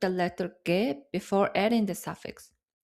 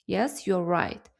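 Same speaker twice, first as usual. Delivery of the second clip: usual speaking voice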